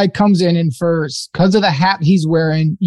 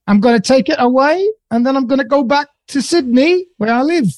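higher-pitched mock Australian; Australian accent; 5 semitones higher than usual. Shown as a demonstration. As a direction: play an Australian customer with a put-on accent. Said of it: "quick little talk as Australians".

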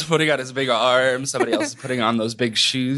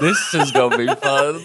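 mock cheer. Nick echoes in a put-on voice.

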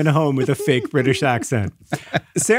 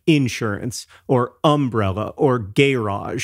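slow Southern INsurance, UMbrella, GArage; Southern accent stress; 2 semitones lower, slower than usual. Shown as a demonstration. Explaining how Southerners talk, Southern first-syllable stress on each word. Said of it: "in Southern accents, people might say".

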